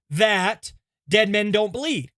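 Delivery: usual speaking voice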